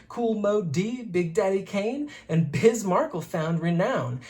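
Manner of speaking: ordinary delivery, nothing marked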